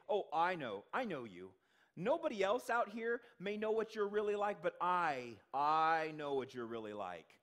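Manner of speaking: "I" punched loud in "I know you" and "but I, I know"; stress on I. Retold or imitated. Scene: Eliab sneers at David, punching every "I" loudly. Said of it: "I" in Hebrew is emphasized".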